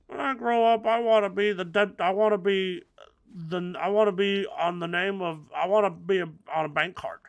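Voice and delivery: put-on high, halting little-kid voice; put-on high child voice; child Timmy. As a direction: stumble eagerly through a child's wish. Child Timmy tells his dream.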